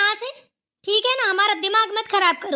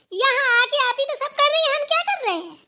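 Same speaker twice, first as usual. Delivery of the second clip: rising outrage at her; heated sarcasm; 6 semitones higher than usual. Acted. Heated sarcasm at sister-in-law.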